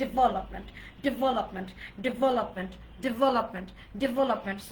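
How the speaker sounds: development as di-VEL-up-ment, stress on the second syllable; correct pronunciation drill. Shown as a demonstration. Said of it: "five times". (development বারবার শুদ্ধ উচ্চারণে, দ্বিতীয় অংশে জোর)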